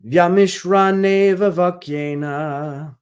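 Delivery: slow, measured Sanskrit chanting; verse recitation. Acted. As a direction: intone the verse slowly.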